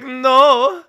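loud, high mock refusal; hammed-up high wail of protest; put-upon prostitute. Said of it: "feeling like a prostitute".

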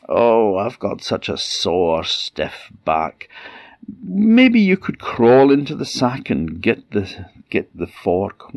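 slow put-on troll voice rising high; troll woman faking pain to trick the boy; wheedling troll. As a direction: whine about a sore back to lure him. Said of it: "troll feigns sore back".

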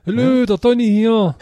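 mock phone greeting. Mock call as Donny.

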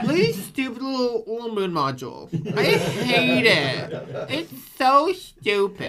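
mock the moon module, hands on hips; sassy Hartman. Imagining Hartman sneering at the moon module.

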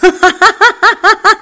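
very high, loud burst of laughter; choking with laughter; helpless laughter. Acted. Raga kiknar av skratt åt Bonkis svar. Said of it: "Raga skrattade så han kiknade".

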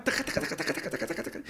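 breathy machine-gun "taca taca"; quick, hushed nonsense patter; rapid-fire chatter imitation. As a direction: rattle it off under your breath, imitating her chatter. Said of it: "ella venía con el taca".

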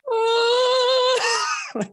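very high, loud panicked squeal; high whistle scream. Retold or imitated. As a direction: shriek in whistle tone falling. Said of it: "this really high".

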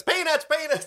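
high shouted peanut vendor cry; stadium vendor hawking. Retold or imitated. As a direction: hawk peanuts like a ballpark vendor. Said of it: "he comes in, like, flinging peanuts".

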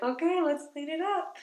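high, sing-song mom cheer; chirpy parent coaxing; bright parent voice. Retold or imitated. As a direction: coax a small child brightly. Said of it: "And I was like".

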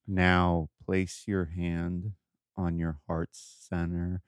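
slow, hushed guide voice; calm meditation voice. Pretend. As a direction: ease them into calm, slowly. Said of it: "You're just like".

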